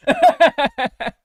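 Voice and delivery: loud burst of laughter; laughing. Real, not acted. Laughs at being caught.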